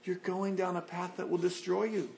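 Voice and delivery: soft tearful warning. Shown as a demonstration. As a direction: plead quietly, close to tears. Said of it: "with tears in our eyes, say".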